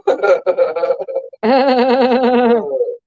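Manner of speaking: long, high cackling laugh; high cackle. Real, not acted. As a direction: rattle off a drawn-out high cackle. Laughing after boasting of accents.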